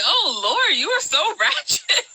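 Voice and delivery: high, laughing "oh Lord"; laughing disbelief. Retold or imitated. Watching the reunion fight, amused laughing disbelief. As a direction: laugh through the word ratchet. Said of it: "I was just like".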